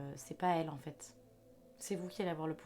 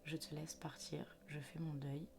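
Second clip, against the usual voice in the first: gentle farewell; 3 semitones lower, 9 dB quieter than usual. Retold or imitated. Farewell to a deceased loved one.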